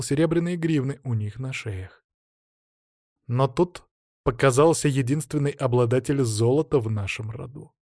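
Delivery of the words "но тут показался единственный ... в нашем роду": ordinary delivery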